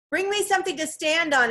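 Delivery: high-voiced call from below; high, insistent instruction; urgent request. A narrator's reading. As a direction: call up with pressing need. Trapped girl calls instructions.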